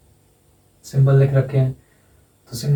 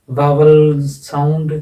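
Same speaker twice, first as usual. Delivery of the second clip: "Vowel sound" stretched very slowly, a touch louder; drawn-out term; 4 dB louder, much slower than usual. Shown as a demonstration. Teacher to students, naming the key term slowly and deliberately. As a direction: spell out the term slowly so pupils catch it. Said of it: "teacher stresses term for students".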